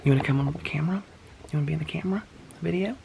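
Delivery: quick hushed whisper to the pet. Real, not acted. Asking the pet to join the video in a hushed whisper. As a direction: whisper it fast and close.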